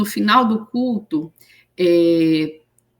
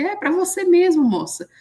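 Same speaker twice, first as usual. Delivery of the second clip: insisting call; 11 semitones higher, 4 dB louder than usual. Retold or imitated. Preacher insisting it's for her.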